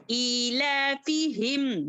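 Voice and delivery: slow chant of ईला फी हिम, stretched; Quran recitation. Shown as a demonstration. Teacher models the verse for students, slow chant. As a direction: recite ईला फी हिम slowly, each syllable held. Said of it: "कैसे पढ़ेंगे?".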